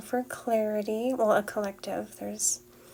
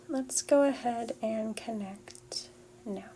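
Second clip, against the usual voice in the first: airy breathy mystical healer. Acted. Leads a Reiki clearing.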